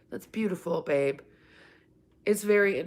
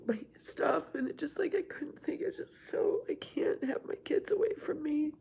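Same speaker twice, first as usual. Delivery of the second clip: tearful whisper; 7 semitones higher, 5 dB quieter than usual. Real, not acted. Mother tearfully recalling son leaving.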